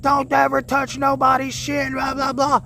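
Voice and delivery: high, imitated rant; mock yelling man. Homeless man telling narrator off, high mock yell. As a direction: ham up the angry man's rant.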